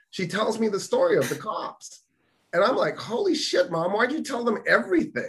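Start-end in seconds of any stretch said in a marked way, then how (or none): 3.0-5.3 high-pitched exasperated disbelief. Retold or imitated. Son retelling his reaction to mom spilling everything, exasperated. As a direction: reproach her in a high, incredulous voice.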